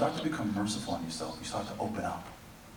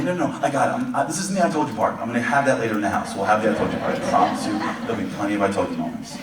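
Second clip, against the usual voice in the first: insistent father; 9 dB louder, faster than usual. Retold or imitated. Father answers, loud and fast.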